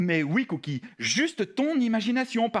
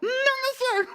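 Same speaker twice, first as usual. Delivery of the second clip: high little puppet voice; frightened puppet; slower than usual. Acted. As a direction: answer as a timid, trembling puppet. Trembling puppet answers Philippe.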